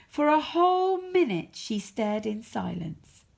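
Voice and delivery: usual speaking voice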